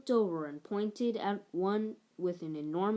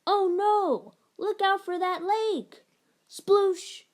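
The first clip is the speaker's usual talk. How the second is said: high, loud alarm for the crash landing; a small pilot yelling as the ship splashes down; excited crash alarm; 11 semitones higher, 7 dB louder, slower than usual. Pretend. Pretend spaceship crash.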